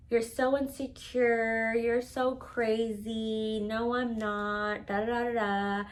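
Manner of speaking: slow, drawn-out sing-song dismissal; mocking imitation. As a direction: stretch each phrase out, mimicking a dismissive partner. Mocking his dismissive denials in retelling.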